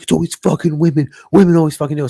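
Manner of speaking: loud, high-pitched mock rant; retold angry yelling. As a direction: imitate a man screaming at a stranger. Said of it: "He's screaming at her".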